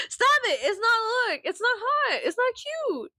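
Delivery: high squeaky scold, stop it not cute; mock-scold them in a high shrill-up voice. Scolding viewers filming double chins.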